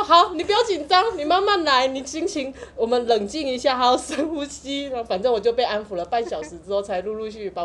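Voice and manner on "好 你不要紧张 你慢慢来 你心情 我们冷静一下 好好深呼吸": mock soothing operator. Operator soothing panicked caller.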